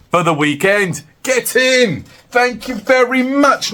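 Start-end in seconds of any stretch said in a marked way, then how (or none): 1.2-3.7 excited. Real, not acted. Celebrating a big sale.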